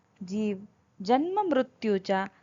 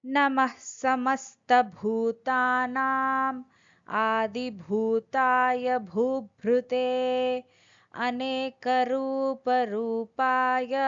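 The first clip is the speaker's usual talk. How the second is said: slow, measured Sanskrit chant; over enunciating; 2 semitones higher, much slower than usual. Acted. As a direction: recite every syllable carefully and slowly. Sanskrit verse recited before translation.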